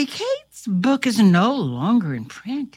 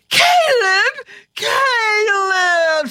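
joyful shriek; 9 semitones higher, much slower than usual. A narrator's reading. Kate recognising Caleb: high joyful shriek. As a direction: shriek his name in delight. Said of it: "she shrieked".

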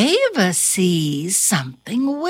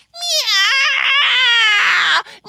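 very high, louder yowl; crying meow; 14 semitones higher, 4 dB louder than usual. Acted. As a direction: wail like a cat. Cat joins howl.

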